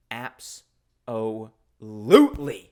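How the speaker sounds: slow, drawn-out cool duck absolutely; Floyd D Duck. Retold or imitated. Vlogger voicing the duck mascot, slow drawn-out absolutely. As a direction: stretch it out like a swaggering cartoon duck. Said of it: "that's the way he would say absolutely".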